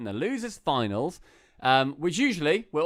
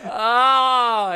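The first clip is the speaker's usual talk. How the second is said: loud high laughing "ah"; laughing cry; 8 semitones higher, 9 dB louder than usual. Real, not acted. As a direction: burst out laughing loudly. Laughing while recalling rival's loss.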